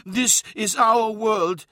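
slow, high-pitched, emphatic; indignant elder's voice; angry defiance. A narrator's reading. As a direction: stake the claim angrily, word by word. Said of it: "saw his anger".

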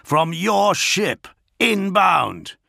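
high, slow, punched-out words; barked accusation. A narrator's reading. Augustin accuses the lion of an attack, barking high. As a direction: bark it in weighty chunks. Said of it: "Augustin barked".

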